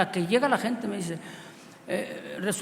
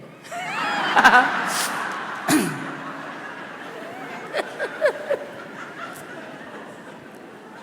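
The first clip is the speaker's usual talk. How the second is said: long high breathy burst of laughter; preacher cracking up at his own story; big laugh; 13 semitones higher than usual. Real, not acted. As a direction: let the laugh explode out.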